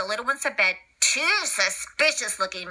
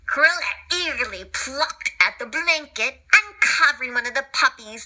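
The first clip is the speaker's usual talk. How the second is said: usual speaking voice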